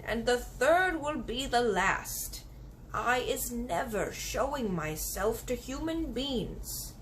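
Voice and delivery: put-on slow, gentle old giant, firm; the kindly giant refusing, drawn out. Giant refusing the girl, slow and firm. BFG voiced in story.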